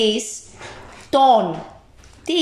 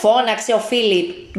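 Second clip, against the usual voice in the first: ordinary delivery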